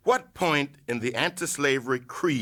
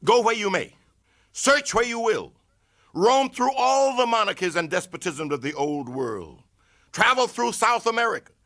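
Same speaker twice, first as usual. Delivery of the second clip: rising to a full furious shout, higher and louder; furious oratory; 8 semitones higher, 5 dB louder than usual. Acted. Orator condemning the nation's Fourth of July, furious shout. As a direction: thunder it at the crowd, building all the way.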